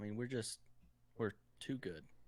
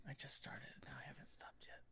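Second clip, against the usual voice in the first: soft unvoiced whisper into the mic; 3 semitones higher, 19 dB quieter than usual. Real, not acted. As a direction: whisper as if not to disturb anyone. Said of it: "Okay, I'll stop whispering".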